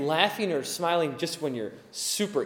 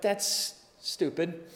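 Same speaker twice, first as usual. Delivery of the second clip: slow, softer deadpan wife; wife dismissing her husband's rule; dry wife retort; 5 dB quieter, much slower than usual. Retold or imitated. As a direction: flatly dismiss it, slowly. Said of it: "She's like".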